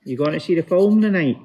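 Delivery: mimicking a West of Scotland cousin, lively; West of Scotland accent. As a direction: do a broad West coast Scot, quick and up. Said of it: "cousins through the West saying that one".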